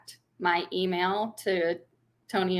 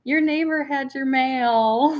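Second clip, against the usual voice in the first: slow, higher-pitched imitated announcement; appraiser; 6 semitones higher, 4 dB louder, slower than usual. Appraiser hands over mail at the door, slow imitated voice. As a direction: report it slowly, pitched up. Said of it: "he's like".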